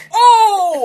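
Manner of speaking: loud, high "oh!"; spectator's outburst at a hit; shocked shout. Acted. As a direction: yell out in mock shock. Reacting to seeing the slap fight.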